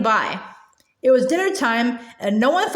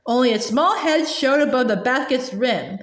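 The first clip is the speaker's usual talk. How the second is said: plain reading voice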